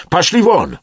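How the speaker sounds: high, loud shout; explosive order to get lost; angry dismissal. A narrator's reading. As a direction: yell them away sharply. Emerson dismisses the men in anger.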